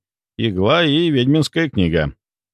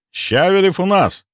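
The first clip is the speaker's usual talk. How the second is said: joyful; 8 semitones higher than usual. A narrator's reading. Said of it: "радостно проговорил он".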